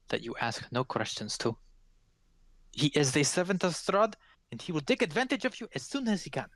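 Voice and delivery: wary woman measuring out her counsel; cryptic Vistani woman. Acted. Vistani woman warns travelers about Strahd's servant, cryptic and accented.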